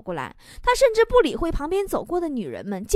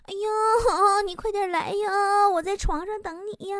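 high, soft, drawn-out come-on; cheeky flirting; 6 semitones higher, 3 dB quieter, slower than usual. Acted. Patient teasing a pretty nurse from bed, high cooing flirtation. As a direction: coo it teasingly, stretching the endings. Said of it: "于是我开始嘴贱了".

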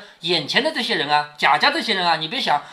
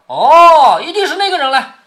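acted sudden realization; 7 semitones higher, 9 dB louder than usual. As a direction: play Jia Lian thinking he has guessed it. 贾琏恍然猜到的台词. (恍然大悟，高声拖长)

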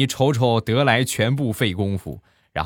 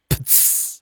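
unvoiced spraying sound; plunger splurt noise. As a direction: spit out the splash of the plunger. (模仿喷水的噗呲声)

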